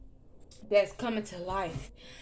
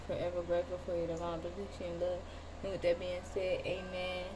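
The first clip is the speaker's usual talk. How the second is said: soft, hushed prayer before the reading; protective prayer; 6 dB quieter than usual. Real, not acted. Tarot reader praying before a reading, soft prayer. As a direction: murmur the opening blessing.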